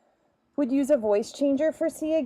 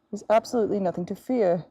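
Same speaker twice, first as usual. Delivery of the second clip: rushed mock reassurance; faster than usual.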